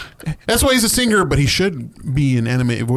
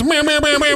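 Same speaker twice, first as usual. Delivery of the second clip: high repeated air-horn blasts by mouth; imitated dance hall horns. Host filling in for missing sound effects, mimicked horn blasts. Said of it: "Any dance hall horns?".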